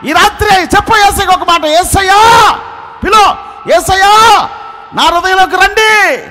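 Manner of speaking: high, loud pleading cry to Jesus; shouted prayer lead. Acted. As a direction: lead the women crying out to God. Leads women in loud prayer. (గట్టిగా అరుస్తూ ప్రార్థన)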